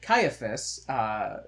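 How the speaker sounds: ordinary talk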